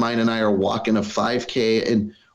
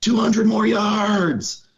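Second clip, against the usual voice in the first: high, slow call carried down the slope; man at the top yelling encouragement to the climbers; retold yell; 11 semitones higher, much slower than usual. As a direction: call it out slowly like from the summit. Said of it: "some guy at the top yelling".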